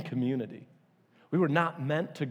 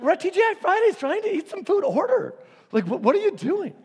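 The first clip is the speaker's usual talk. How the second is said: high, loud exasperated scolding; exasperated rant; 6 dB louder than usual. Retold or imitated. Scolding a daydreaming friend at TGI Fridays, loud and high. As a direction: let it fly in mock outrage.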